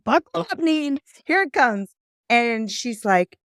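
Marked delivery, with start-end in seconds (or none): 0.0-1.9 high-pitched, playful self-warning; mock-alarmed pep talk to oneself; comic bracing. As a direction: brace yourself for the onslaught, voice pitched up. Bracing herself for client's tirade.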